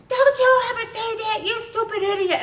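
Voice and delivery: high, loud angry snap; loud furious scolding; angry outburst. Shown as a demonstration. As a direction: shout it at them in anger. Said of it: "and then I react with anger".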